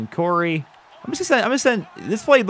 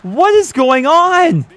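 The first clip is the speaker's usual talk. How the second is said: high, slow, louder cry of disbelief; exasperated cry; 14 semitones higher, 6 dB louder, much slower than usual. Real, not acted. Gamer fed up with drops, high drawn-out cry. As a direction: drag it out, fed up and baffled.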